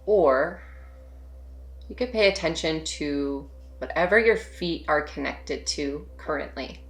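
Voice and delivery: soft, unhurried meditation voice with long pauses; hushed, spacious meditation guidance; guided meditation. Real, not acted. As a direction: gently guide them to settle and notice, leaving space between phrases.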